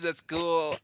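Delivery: normal talking voice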